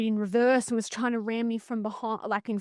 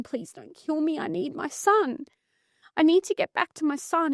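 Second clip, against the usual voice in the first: higher, pleading retelling of a terrified thought; raised voice, reliving a fearful plea; retold desperate plea; 6 semitones higher than usual. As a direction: plead for your life as you remember it. Retold plea while being strangled.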